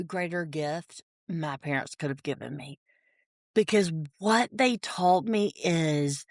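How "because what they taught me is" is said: normal talking voice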